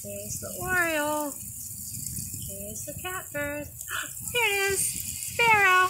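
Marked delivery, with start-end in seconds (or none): 3.7-4.3 soft excited gasp; mock gasp. Teacher pretends to spot the bird, soft gasp. Spotting game. 4.3-5.9 excited spotting. Shown as a demonstration. Teacher plays the spotting game, excited. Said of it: "We'll just say sparrow".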